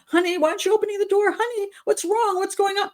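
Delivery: high, syrupy Honey, what's wrong; fake sweet boyfriend. Imagining the killer pretending, high sugary concern. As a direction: play the innocent worried lover. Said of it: "He would have gone".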